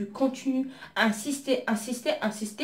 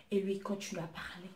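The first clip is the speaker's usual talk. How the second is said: normal talking voice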